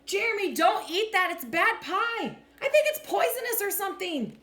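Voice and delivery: loud, high, urgent cry to stop a friend; panicked warning. Acted.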